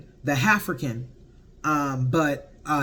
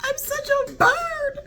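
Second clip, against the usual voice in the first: giddy self-mockery; slower than usual. Real, not acted. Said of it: "tells me that I am toxic".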